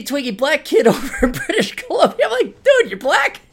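high squeaky giggling question; cracking up while asking; laughing through the words in disbelief. Retold or imitated. Retelling a surprised phone chat.

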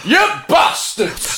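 high, slow Yorkshire yell; stretched-out, strained Yorkshire shout; Yorkshire accent. Acted. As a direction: curse like a hammed-up Yorkshire driver. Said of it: "Yorkshire train driver also said".

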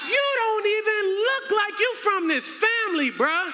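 very high, hammed-up shouting; mock-outraged rejection. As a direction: shout down an impostor in mock disbelief. Acted royals reject him.

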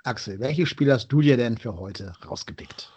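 ordinary talk

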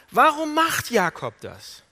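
loud, high, slow demand; impassioned questioning. Acted. Preacher asking the congregation about Jacob's deceit, impassioned. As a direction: hurl the question out slowly.

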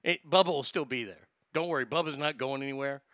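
ordinary delivery

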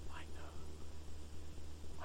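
a hushed, intimate whisper; I know whispered with reverence; whispered God. Acted. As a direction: breathe the words like a secret. God's voice quoted softly to congregation.

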